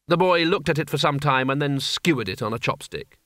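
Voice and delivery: plain reading voice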